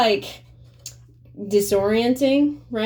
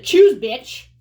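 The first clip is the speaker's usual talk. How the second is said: higher, louder sneer of "Choose, bitch."; mock snarl; 6 semitones higher, 8 dB louder than usual. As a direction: spit it as a contemptuous mock insult. Mocking what he meant.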